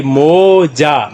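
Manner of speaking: "मोजा" slow, with a long "मो" and long "जा"; syllable by syllable. Shown as a demonstration. Teacher telling learners what it makes, "मोजा" slowly drawn out. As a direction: stretch both halves of "मोजा". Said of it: "तो क्या बन गया जी".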